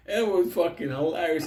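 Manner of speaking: ordinary delivery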